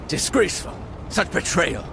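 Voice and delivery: high, indignant exclamations; indignant outrage. Acted. King reacting to the events in Lyria, outraged. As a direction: burst out, appalled at the treachery.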